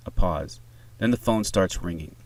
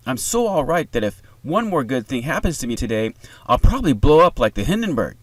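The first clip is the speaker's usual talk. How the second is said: put-on raised, bubbly teenage gush; happy, bubbling; 5 semitones higher, 5 dB louder than usual. A narrator's reading. Daughter reporting good news to mom, happy and bubbling. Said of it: "happy, bubbling voice".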